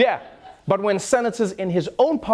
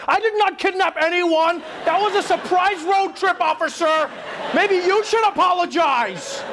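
high-pitched, indignant mock protest; overdefensive protest; 10 semitones higher than usual. Driver protesting to the cop who pulled him over, overdefensive. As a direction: blurt out a far-too-defensive denial, high and drawn out at the end.

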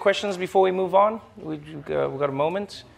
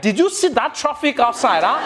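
higher, louder Nigerian accent on "Did you see that traffic outside, huh"; 8 semitones higher, 4 dB louder than usual. Shown as a demonstration. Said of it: "when Nigerians come inside".

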